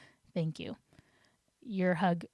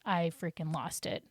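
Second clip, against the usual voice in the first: ordinary talk, nothing marked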